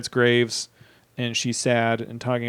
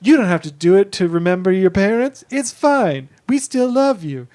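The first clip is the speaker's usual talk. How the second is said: a lightly raised, gentle mock dad voice, higher in pitch; dead dad's voice; 6 semitones higher, 4 dB louder than usual. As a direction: pretend to be her late father and comfort her. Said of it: "doing a voice and just being like".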